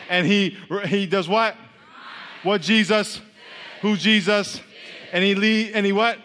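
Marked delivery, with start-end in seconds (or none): none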